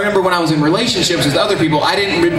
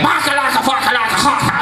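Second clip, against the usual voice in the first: gibberish attendant; 7 semitones higher than usual. Retold or imitated. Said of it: "And he's like".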